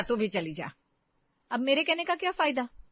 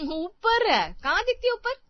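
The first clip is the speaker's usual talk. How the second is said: bitter retort; 10 semitones higher, 4 dB louder than usual. Acted. Elder daughter-in-law confronting her mother-in-law, angry and hurt. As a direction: pitch climbing much higher, louder, accusing.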